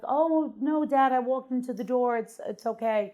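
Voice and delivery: high, bright-eyed fake innocence; innocent excuse to dad. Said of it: "And I was like".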